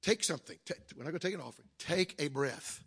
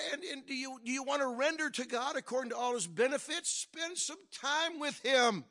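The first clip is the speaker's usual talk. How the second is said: high-pitched, insistent pulpit delivery; urgent sermon exhortation, building at the end; exhortation preaching; 7 semitones higher than usual. Real, not acted. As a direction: press each step on the congregation.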